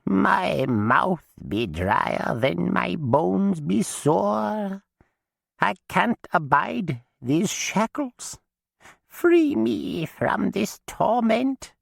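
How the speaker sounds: very high, very slow suffering voice; tormented dead witch. A narrator's reading. Chained witch begs Alice to free her, slow. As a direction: drag out every word of torment. Maggie speaking.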